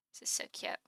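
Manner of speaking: breathy whisper of delight; whispered coo. Real, not acted. Streamer whispering over a cute screenshot. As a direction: whisper it like it's adorable.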